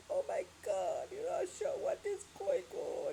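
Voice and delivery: high, shocked cry of disbelief; mock alarmed crowd. Everybody reacting to Russia's attack, mock alarm. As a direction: gasp it out like panicked onlookers. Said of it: "everybody's like".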